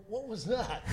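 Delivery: high, laughing what-was-that; laughing surprise. Retold or imitated. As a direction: burst out in startled amusement. Surprised by puck thrown at him.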